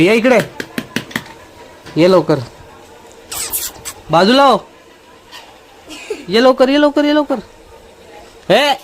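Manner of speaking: high, loud eager call to the dog; excited calling. Real, not acted. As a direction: call the dog over, bright and urgent. (कुत्र्याला उत्साहाने, मोठ्याने हाक मारणारा आवाज)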